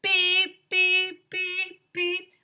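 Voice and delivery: high, loud mock alarm beeps; high, even electronic beeps; imitated beeping. A narrator's reading. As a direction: beep like a smoke detector. Smoke detector keeps beeping.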